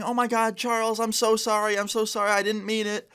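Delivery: high-pitched pleading girlfriend imitation; hammed-up high, panicky apology; mock frantic apology. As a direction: plead after him in a high, held-up voice. Retold girlfriend chasing and apologising.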